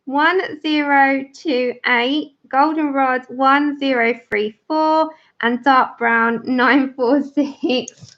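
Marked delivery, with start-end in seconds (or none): none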